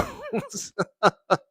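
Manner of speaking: high squealing laugh. Real, not acted. Host to chat about her skin, high squealing laugh.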